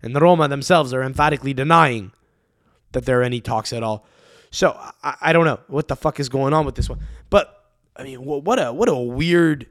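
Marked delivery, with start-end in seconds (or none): none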